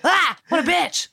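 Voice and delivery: high mock-startled gasp, then insult; hammed-up affront; mock outrage. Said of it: "they're like".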